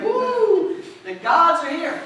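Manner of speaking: high, loud cheer for the gods; excited whoop. Acted. The priest welcoming the gods, loud excited whoop.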